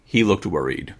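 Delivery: plain reading voice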